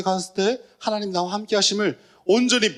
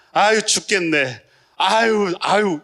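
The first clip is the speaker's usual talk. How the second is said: mock groaning; 3 semitones higher, 5 dB louder, much slower than usual. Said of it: "이렇게".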